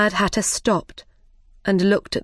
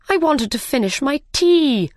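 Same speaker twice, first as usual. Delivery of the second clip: higher, put-out protest; complaining Hatter; 7 semitones higher, 3 dB louder than usual. A narrator's reading. Mad Hatter complaining to the King, higher-pitched protest.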